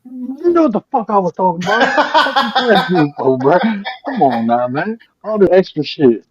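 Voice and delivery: high-pitched, laughing mock exasperation; exasperated retort. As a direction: act out protesting at being corrected, half laughing. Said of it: "And I'd be like".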